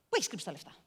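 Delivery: soft, menacing question; retold quiet threat to the girl; quiet interrogation. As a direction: ask it low and dangerous. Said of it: "τη ρωτάω".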